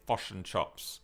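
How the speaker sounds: New Zealand "fish and chops", said "fush and chops"; New Zealand vowels on "fish and chops"; New Zealand accent. Shown as a demonstration. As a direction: say it like a Kiwi, short I flattened to UH. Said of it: "They say".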